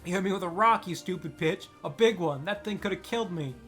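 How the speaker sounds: loud, high outraged shout; bully boy screaming accusations; angry yell. Acted. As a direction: yell at her, hurt and furious. Boy shouts at old woman.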